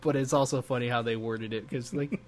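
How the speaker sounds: ordinary delivery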